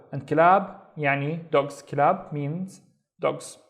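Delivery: ordinary talk, nothing marked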